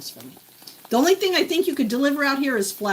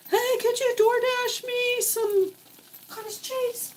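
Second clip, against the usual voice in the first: much higher, slow squeaky request; high mock voice; 13 semitones higher, slower than usual. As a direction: ask sweetly in a high little voice. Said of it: "I can't be like".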